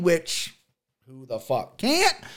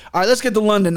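normal talking voice

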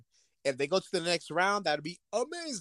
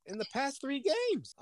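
high mock owner complaint; 9 semitones higher, slower than usual.

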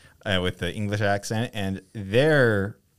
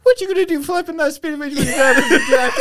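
loud, high, hammed-up movie impression; 7 dB louder than usual. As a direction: belt out the slang like a comic bit.

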